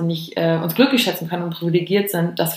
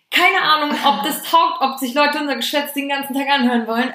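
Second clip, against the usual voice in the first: high excited voice; 7 semitones higher than usual. Retold or imitated. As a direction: toss the doubt off brightly. Retelling their own doubt.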